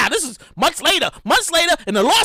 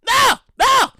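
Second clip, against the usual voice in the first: normal talking voice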